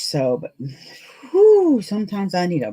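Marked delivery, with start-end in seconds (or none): 1.3-1.8 high, loud "whoo" of weariness; whew. Real, not acted. Sewer needing a break from batch work, loud whew. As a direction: let out a high, voiced whew.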